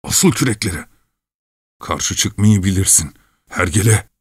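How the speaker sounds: irritated, scolding grumble; angry grumbling. A narrator's reading. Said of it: "diye kızgın kızgın homurdanıyordu".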